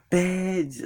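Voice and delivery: high-pitched, held-back mock shout; high mock yell without full volume; imitated yell. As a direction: squeal it as a retold outburst. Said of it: "yelling so loud".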